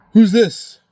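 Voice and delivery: man answering a call from police, suspicious and loud; suspicious demand. Retold or imitated. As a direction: bark it back at the unknown caller. Teller answers the cops' call.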